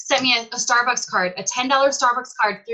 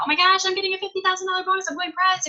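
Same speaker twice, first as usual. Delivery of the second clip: high, rapid re-enacted gush; excited gushing; 10 semitones higher, faster than usual. Seller retelling bonus news to new friends, excited gushing.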